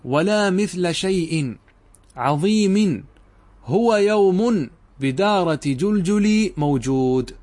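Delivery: slow, weighty paraphrase with a slight lift in pitch and volume; slow demonstration reading. Shown as a demonstration. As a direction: lay out the paraphrase slowly for the class. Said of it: "انظر ماذا فعلنا".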